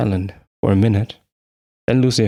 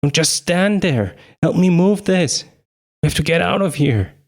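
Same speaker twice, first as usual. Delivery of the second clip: high frantic screaming; 8 semitones higher than usual. A narrator's reading. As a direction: scream it in panic. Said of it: "looked up at Lucia and screamed".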